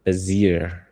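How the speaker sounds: Bezier as 'beh-ZEER', long second syllable; trial pronunciation. Shown as a demonstration. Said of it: "I have no idea how we would say".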